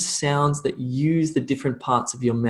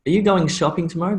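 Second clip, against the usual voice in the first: fast, higher question with GOING SHOPPING run together; natural connected speech; 4 semitones higher, much faster than usual. Shown as a demonstration. Teacher showing how natives connect words, quick natural demo. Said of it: "when you start to speak ... naturally".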